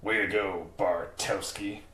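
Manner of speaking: slow, soft gruff growl through teeth; Casey impression through gritted teeth. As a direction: drag out Bartowski, teeth clenched. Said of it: "it's getting better and better".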